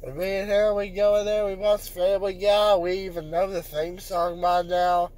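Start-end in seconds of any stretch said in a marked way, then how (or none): none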